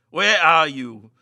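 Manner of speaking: loud call into the dark. A narrator's reading. Meagle calling for Barnes in the dark house. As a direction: call down the passage. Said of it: "he called".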